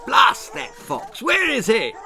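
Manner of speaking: high, loud furious roar; thundering fury. A narrator's reading. Lord Percy thundering in fury at losing the fox. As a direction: rage at the vanished fox. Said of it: "thundered Lord Percy in his fury".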